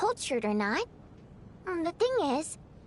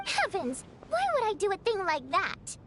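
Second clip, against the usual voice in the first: normal talking voice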